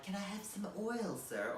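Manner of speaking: small, high British urchin voice; Oliver Twist. Acted. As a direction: plead meekly in a put-on British accent. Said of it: "like a big American Oliver Twist".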